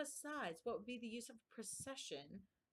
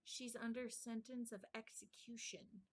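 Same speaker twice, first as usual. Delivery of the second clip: whispered secret; 2 semitones higher, 5 dB quieter than usual. A narrator's reading. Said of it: "and whispered".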